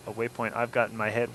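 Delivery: normal talking voice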